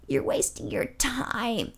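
slow, hushed, eerie croak; creepy old lady. Retold or imitated. Old lady in the wheelchair warns him, slow and eerie. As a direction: spook him like a horror movie ghoul. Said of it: "kinda like a horror movie".

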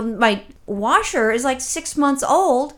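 slow and drawn out, spelling it out; slow emphatic. Retold or imitated. Telling the agent how new the washer is, slow and emphatic. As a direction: lay each word down slowly to make the point.

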